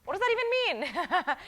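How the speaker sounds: high-pitched, incredulous question; baffled, pitch leaping up. Acted. Coach acting out a baffled reaction to an answer, incredulous.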